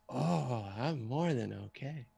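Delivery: put-on soft, dreamy blissed-out drunk; drunk dude. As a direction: answer hushed, as if floating in a stupor. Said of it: "acted like a drunk dude".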